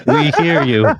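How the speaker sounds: loud, high first-contact voice; addressing aliens. Acted. Talking to a garbled "alien" guest.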